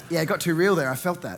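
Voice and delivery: ordinary talk, nothing marked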